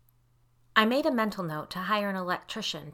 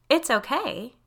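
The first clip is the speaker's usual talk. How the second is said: higher, louder, forced-light question; strained cheer, pitched up; forcibly light; 7 semitones higher, 7 dB louder, slower than usual. A narrator's reading. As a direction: check back with put-on lightness. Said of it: "my tone still forcibly light".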